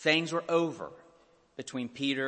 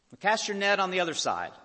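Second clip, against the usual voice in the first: calling out; 6 semitones higher, 5 dB louder, faster than usual. Retold or imitated. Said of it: "yells out".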